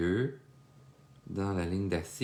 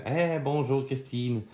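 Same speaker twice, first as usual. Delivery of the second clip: friendly greeting; 5 semitones higher, 3 dB louder than usual. Retold or imitated. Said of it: "vous me dites que vous êtes à Québec".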